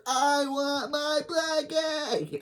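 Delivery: high, slow whiny wail; tantrum wail. Acted. Khloe the menace demanding her blankie, high wail.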